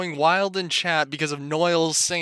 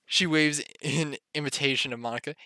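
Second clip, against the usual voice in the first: ordinary talk, nothing marked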